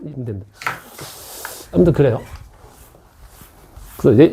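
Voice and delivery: usual speaking voice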